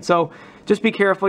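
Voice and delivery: ordinary talk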